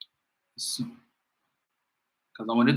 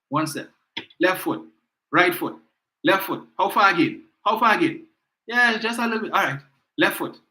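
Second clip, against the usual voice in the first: put-on higher, halting voice of a frail old leader needing help; mocking Kamla impression; 4 semitones higher than usual. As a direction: act out a leader who can barely step out of the car.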